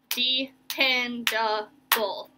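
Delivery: "dependable" very slow, four even beats; four separate syllables. Shown as a demonstration. Teacher introducing the longest word, "dependable" in four slow parts. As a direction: sound out "dependable" one syllable at a time. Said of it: "has four syllables".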